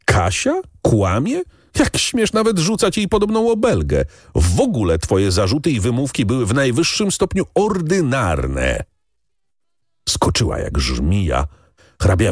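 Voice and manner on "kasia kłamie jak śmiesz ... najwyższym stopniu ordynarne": high-pitched affronted rebuke; outraged brother's reproach; stern indignation. A narrator's reading. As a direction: bristle at the insult, pitch climbing. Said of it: "Mochyński nasrożył się".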